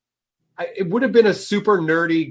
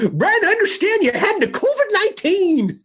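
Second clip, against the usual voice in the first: put-on high, old-timey interviewer; old prospector voice; 13 semitones higher than usual. Restarting the podcast in old-timer voices, a high prospector interview. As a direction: ask it like a mumbling prospector. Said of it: "did it that way?".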